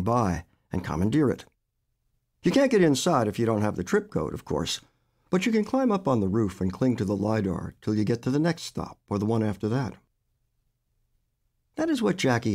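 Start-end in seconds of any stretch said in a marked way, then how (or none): none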